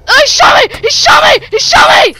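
high, loud outraged yell; shocked shouting. Real, not acted. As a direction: scream it in shock. Shot by another player.

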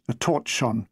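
ordinary delivery, nothing marked

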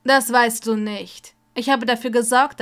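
loud furious declaration; angry. A narrator's reading. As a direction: lean in and hurl it angrily. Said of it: "stierte Snape wütend an".

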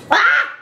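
sudden high shriek to startle; startling boo. Real, not acted. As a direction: jump out and yell ah. Startling her partner.

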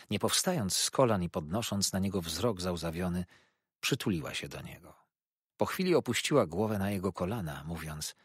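ordinary delivery

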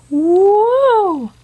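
high loud woo; real delighted whoop; excited cheer. Real, not acted.

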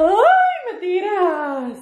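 high startled cry; real startled cry; startled yelp. Real, not acted. Splashed by shower.

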